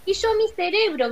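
a touch of a desperate shout; cry out. A narrator's reading. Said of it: "gritó el espantapájaros".

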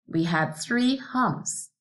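ordinary talk

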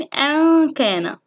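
slow أن كان, noon held as a hum; ikhfa demonstration. Shown as a demonstration. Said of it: "مثال من كلمتين". (إخفاء النون بغنّة في أن كان)

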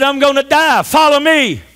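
high, forceful preacher's proclamation; emphatic pulpit command; commanding call. Acted. Declaims Jesus' words loudly.

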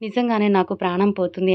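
usual speaking voice

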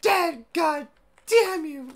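high, loud mock anguish; dramatic wail. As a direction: wail it like a tragedy. Dramatic wail over lost audio.

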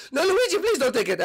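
high, hammed-up begging; panicked pleading. Luigi runs off with Switch.

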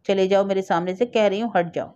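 plain reading voice

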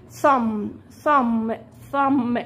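"ثم" said ending on the m, no b; teacher drilling "ثم" for thumb with silent b. Shown as a demonstration. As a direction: stop on the m in "ثم", b silent. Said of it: "انا بقف لحد الام".

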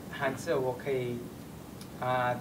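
ordinary delivery

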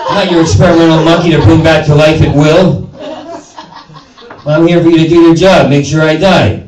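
loud fast rant. Acted. Comic ranting at the doctor who saved his life, loud and fast. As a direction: rail at him.

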